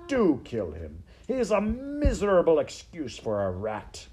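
loud, swooping contempt; shouted scorn. Acted. Rat urges mouse to kill the weakling, shouted scorn. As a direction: spit out the insult, pitch climbing high. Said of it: "shouted Botticelli".

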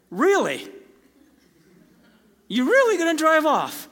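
a pointed, incredulous challenge; incredulous Holy Spirit. Retold or imitated. The Holy Spirit scolding him as he drives off, incredulous. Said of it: "the Holy Spirit's literally just speaking".

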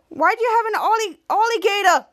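high, loud, incredulous question; put-on alarmed outcry; acted alarm. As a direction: ask it in mock panic. Schwartz alarmed by the alligator.

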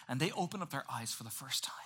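whispered, full of awe; whispering in wonder; hushed whisper. A narrator's reading. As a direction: breathe it like a secret. Wonder at creation.

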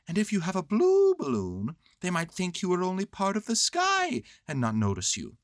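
high, earnest storybook bear; Winnie the Pooh. Acted. Bear explaining balloon plan, high pondering voice.

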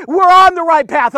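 ordinary delivery

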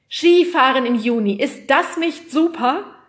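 high, loud, enthusiastic patter; excited TV presenter. Acted. Weatherman on skis in June, excited. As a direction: sell it to the viewers, stretching the ending.

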